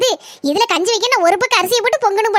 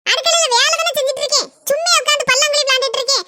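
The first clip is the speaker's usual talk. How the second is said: higher, sarcastic snap back at the husband; sarcastic retort; 6 semitones higher than usual. Acted. Wife answering her husband's call with a higher sarcastic snap. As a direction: fling the sarcasm back at him.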